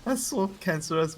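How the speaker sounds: high-pitched, laughing disbelief; laughing exasperation. Real, not acted. Caster griping to co-caster about an annoying Ogre, laughing exasperation.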